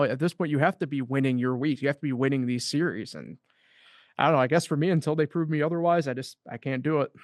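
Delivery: usual speaking voice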